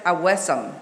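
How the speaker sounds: "awesome" said as uh-WESS-um; mispronounced awesome. Retold or imitated. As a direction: say "awesome" in three syllables, stressing WESS. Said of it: "I pronounced it".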